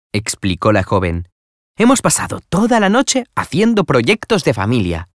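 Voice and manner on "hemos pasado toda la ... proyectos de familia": put-on higher, bright-eyed young woman coaxing a friend; eager young lady. A narrator's reading. Young woman coaxing her friend to come see Pablo, eagerly. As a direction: Florentina pressing Nela to come see Pablo, high eager voice. Florentina explains.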